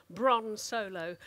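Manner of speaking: usual speaking voice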